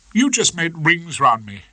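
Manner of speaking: lightly voiced Miss Trant, distressed; young barrister giving up, upset; doleful young barrister.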